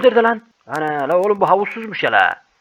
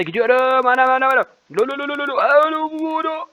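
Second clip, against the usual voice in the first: screaming; 8 semitones higher than usual. Real, not acted. Screaming down slide.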